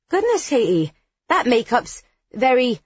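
react, taken aback, searching for a polite word; surprised. A narrator's reading. Biddy gapes at narrator in surprise.

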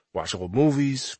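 ordinary delivery, nothing marked

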